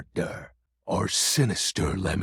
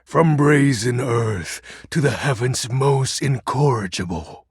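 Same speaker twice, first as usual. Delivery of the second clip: ordinary talk, nothing marked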